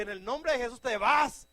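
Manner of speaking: loud, high rebuke; commanding shout. Shown as a demonstration. Preacher showing how to cast out demons, shouting. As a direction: order the demon out with force. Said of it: "es fuerza, grita".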